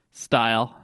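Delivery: ordinary talk, nothing marked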